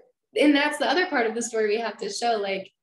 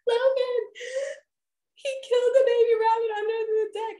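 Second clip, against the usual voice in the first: very high, tearful wail; mock crying yell. Upset wife calling husband about the rabbit, mock crying. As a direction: imitate wailing to someone across the house. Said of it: "I cried and yelled in the house".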